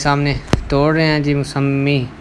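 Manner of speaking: ordinary delivery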